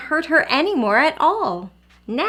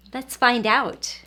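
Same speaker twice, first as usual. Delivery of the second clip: usual speaking voice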